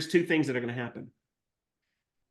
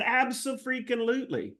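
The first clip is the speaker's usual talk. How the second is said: eager yes; 8 semitones higher than usual. Retold or imitated. Retold eager reply to buyer.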